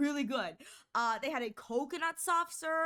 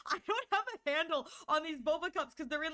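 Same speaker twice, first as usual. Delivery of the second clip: ordinary talk, nothing marked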